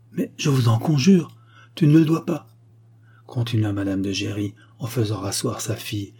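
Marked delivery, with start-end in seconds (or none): 0.1-2.5 higher, louder, urgent imploring; earnest plea. A narrator's reading. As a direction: implore them, voice lifted. Acted plea between mother and daughter.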